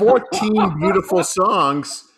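high, slow and loud, savoring each count; gloating grand announcement. Real, not acted. Teasing co-host with the album length, grand drawl. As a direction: stretch it out like a proud showman.

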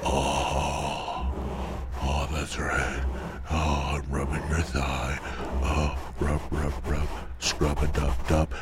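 hammed-up pleasure murmur; mock ASMR moaning. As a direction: croon it like a creepy thigh rub. Parody sensual ASMR rubbing.

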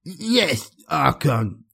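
speaking with a forked tongue; words thick and lisping; impeded speech. Acted. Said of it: "his speech impeded by his newly forked tongue".

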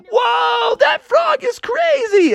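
very high, drawn-out whoop of awe; hammed-up falsetto astonishment; amazed crowd shout. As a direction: exclaim like a stunned fan, high.

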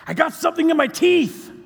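high, loud imitation of an upset man; mock yelled complaint. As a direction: yell it out as his exasperated complaint. Acting out Bill's urgent complaint.

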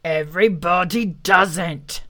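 slow, angry beast voice; growing cross, word by word; put-on voice of an angry animal. A narrator's reading. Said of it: "said the angry voice".

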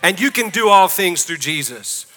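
loud, high, rousing sermon climax; a pastor urging the church to go all in, rousing preaching; full-force preaching. Acted.

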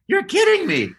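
very high-pitched incredulous squeal; can't-believe-it high voice; retold disbelief. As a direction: squeak it out in mock astonishment.

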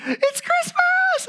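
very high, slow, loud squeal; high-pitched childlike squeal; excited child. Retold or imitated. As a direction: squeal it like a thrilled little girl.

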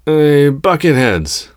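high, drawn-out taunting yell. A narrator's reading. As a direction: holler slowly at the guards. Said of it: "she yelled at the troopers".

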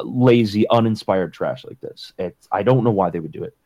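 ordinary delivery

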